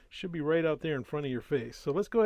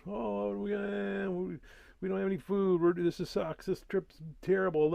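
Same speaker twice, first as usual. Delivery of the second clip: mock-whining Israelites, pitched up; whiny complaining; 5 semitones higher than usual. Hungry Israelites grumbling about the trip, mock whine. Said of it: "they start complaining that".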